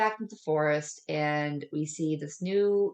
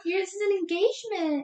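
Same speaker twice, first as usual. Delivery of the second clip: high-pitched mock girly gushing; hammed-up high "oh my gosh" voice; gushing girlfriend. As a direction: squeal it like a thrilled girlfriend. Voicing a giddy girlfriend in retelling.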